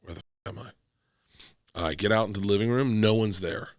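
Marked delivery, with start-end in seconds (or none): 0.0-0.7 dazed mutter, hungover. Retold or imitated. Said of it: "And I'm like".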